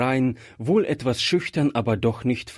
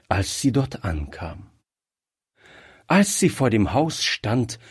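usual speaking voice

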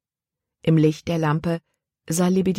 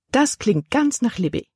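higher, lightly pleased aunt voice; a hint of the aunt's delight, pitched up; 7 semitones higher than usual. A narrator's reading. Aunt pleased about Libby's taste, higher pleased voice. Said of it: "Ihre Tante hörte sich erfreut an".

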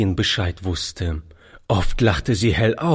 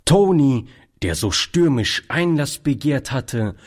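plain reading voice

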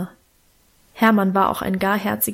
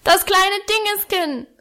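put-on high, sustained child's yell; little boy's insistent high holler; bellowing; 10 semitones higher than usual. A narrator's reading. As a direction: bawl it out at a high pitch. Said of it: "brüllte er".

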